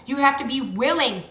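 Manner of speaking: normal talking voice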